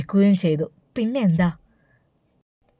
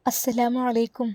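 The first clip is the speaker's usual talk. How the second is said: put-on a soft, sweet young woman's greeting; lightly voiced daughter, soft greeting; 4 semitones higher, 4 dB quieter than usual. A narrator's reading. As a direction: greet her gently and sweetly. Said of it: "ആ മനോഹരമായ ശബ്ദം കേൾക്കുന്നു".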